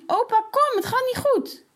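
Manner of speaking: put-on high, loud alarmed child's cry; roepen. A narrator's reading. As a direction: call out to grandpa in alarm. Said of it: "Ik riep". (luid en hoog roepend, geschrokken)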